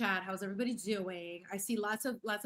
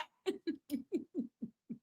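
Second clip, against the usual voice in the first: high, rippling giggles; laughing; 8 semitones higher than usual. Real, not acted. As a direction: keep cracking up. Laughs at her own mockery.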